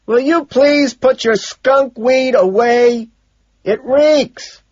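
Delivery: slow, high, loud yell; full-volume scolding shout; shouting at strangers. A narrator's reading. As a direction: holler the complaint word by word. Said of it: "shouts at two dumb-looking".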